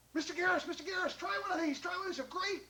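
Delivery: very high, eager pleading; excited pitch. Acted. John pitches berries to Mister Garris, excited. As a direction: call out breathlessly keen to share.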